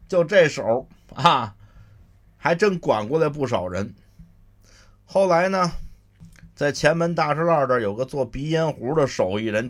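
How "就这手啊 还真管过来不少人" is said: ordinary delivery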